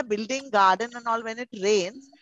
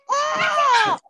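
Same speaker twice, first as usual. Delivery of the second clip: a very high, loud "Oh!" bursting out; delighted surprise; 5 dB louder than usual. Real, not acted. Teacher watching kids jump for attendance, loud high delighted cry. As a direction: gasp out loud with delight at the jumping. Kids jumping at roll call.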